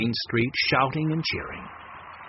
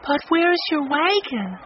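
put-on young girl voice; 13 semitones higher, 3 dB louder than usual. A narrator's reading. Violet voiced by reader.

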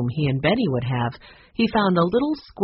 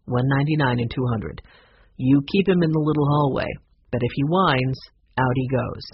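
lower-pitched manager laying down terms; motel manager; 4 semitones lower than usual. Acted. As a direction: play the manager, measured and pitched down.

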